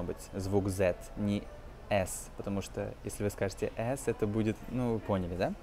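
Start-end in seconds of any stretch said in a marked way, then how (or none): none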